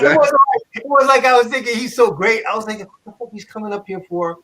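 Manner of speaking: high, laughing disbelief; giggling while telling the grudge; amused mock grudge; laughing retelling. Real, not acted.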